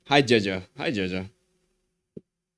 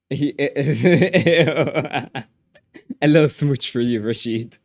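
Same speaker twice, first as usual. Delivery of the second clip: laughing; 2 semitones higher, 4 dB louder, much slower than usual. Pretend. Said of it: "Give us a kiss up close".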